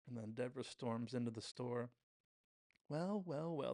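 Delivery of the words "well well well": high, drawn-out taunting greeting; hammed-up knowing villain; mock confrontation. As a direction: imitate a rival who has caught someone out. Shop owner confronts a rival.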